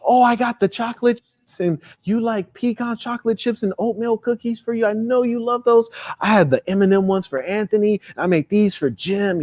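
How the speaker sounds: aunt greeting her nephew with delight; thrilled aunt. Retold or imitated. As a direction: gush over the boy like a doting aunt. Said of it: "she was just so thrilled to see you".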